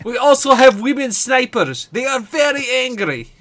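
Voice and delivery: put-on playful Russian accent in broken English; hammed-up Russian soldier, proud and blunt; mock Russian accent, playing it up for the stream. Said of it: "he loves your Russian accent".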